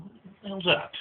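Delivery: high-pitched curious questioning; mimicking someone suddenly intrigued. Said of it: "that intrigues Kasuga".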